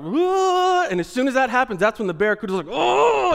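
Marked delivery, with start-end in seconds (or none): 0.0-0.9 high frightened shriek; panicked shriek. Pretend. Imagined wife freaking out. 2.7-3.3 high, loud attack yell; attacking barracuda cry. Pretend.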